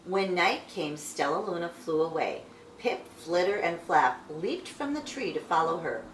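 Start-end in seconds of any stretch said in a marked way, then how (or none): none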